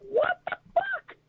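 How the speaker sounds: high, squealing mock outrage; high-pitched shocked exclamation. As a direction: shriek it like you can't believe the price. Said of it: "I'm like".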